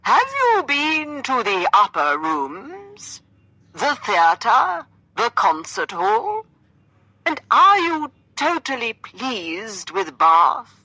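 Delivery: high, loud, slow theatrical grilling; actor's voice. A narrator's reading. Gentleman continuing his questions, in a hammed-up actor's voice. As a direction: declaim each question with grand swoops. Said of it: "continuing with his actor's voice".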